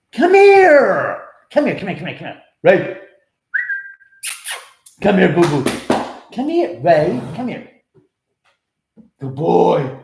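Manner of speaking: high, loud coaxing dog call. Real, not acted. Owner calls his dog over, high and loud coaxing. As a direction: coax him over in doggy voice.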